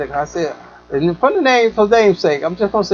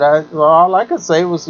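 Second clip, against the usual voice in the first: usual speaking voice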